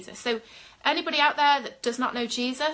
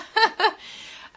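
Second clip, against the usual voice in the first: bright burst of laughter, pitched up; real laugh; 11 semitones higher, 3 dB louder than usual. Real, not acted. Minister laughs at viewers yawning out demons, delighted laugh.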